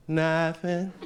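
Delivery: lightly voiced kid saying Nothing; kid's answer. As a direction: innocent little kid answer.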